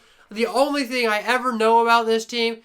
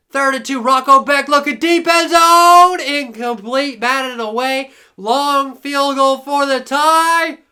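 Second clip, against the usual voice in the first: lively sports announcer call; play-by-play; 5 dB louder than usual. Acted. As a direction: call the action as it unfolds. Live play-by-play of game.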